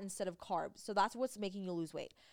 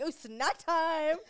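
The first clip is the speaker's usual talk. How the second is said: slow, louder, higher sing-song glee; mock excitement; 7 semitones higher, 8 dB louder, much slower than usual. Mocking her own diet treat with overblown cheer. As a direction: ham up a cheery snack-time treat. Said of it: "I'm like".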